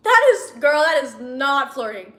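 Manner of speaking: loud, emphatic correction; exasperated objection. Real, not acted. Objecting to the heroine's view.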